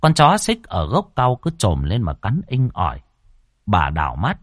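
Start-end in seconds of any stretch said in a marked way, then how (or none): none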